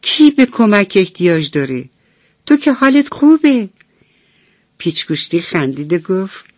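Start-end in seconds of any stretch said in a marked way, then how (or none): 0.0-3.7 rising, high puzzled question; surprised pliers. Acted. Sleepy pliers surprised at screwdriver, high puzzled. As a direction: sound caught off guard. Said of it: "انبر دست با تعجب گفت".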